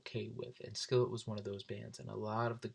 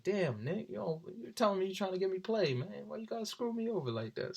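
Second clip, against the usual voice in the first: higher, whiny protest at his friend; mock complaint; 9 semitones higher than usual. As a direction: whine at Nick for ruining it.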